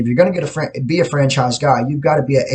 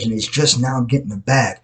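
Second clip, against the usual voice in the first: ordinary delivery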